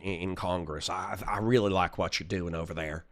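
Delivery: a hint of George Bush's accent, voiced low and soft. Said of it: "I do a really good George Bush accent".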